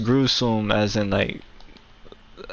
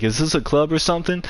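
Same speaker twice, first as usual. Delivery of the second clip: higher, prissy teacher's question; a lightly voiced teacher, higher pitched; mock teacher; 6 semitones higher than usual. Teacher questioning the big crowd, lightly imitated higher.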